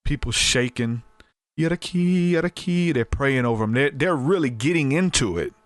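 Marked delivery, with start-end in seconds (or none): 1.6-2.9 high, fervent mock tongues; speaking in tongues. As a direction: imitate worshippers babbling in the Spirit. People praying in the Holy Ghost.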